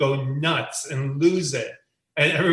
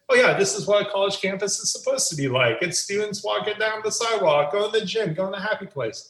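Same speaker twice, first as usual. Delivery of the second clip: cheerful; 6 semitones higher than usual. Pretend. Imagined tourist photo caption.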